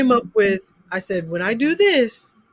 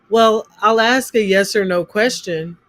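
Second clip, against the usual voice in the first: ordinary talk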